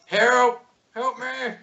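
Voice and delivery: high, squealed plea for help; mock cries for help. Game master as the attacked gnome calls out, high mock scream. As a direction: squeal for rescue in a small high voice. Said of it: "you could hear screams of help".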